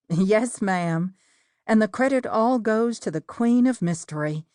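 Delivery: plain reading voice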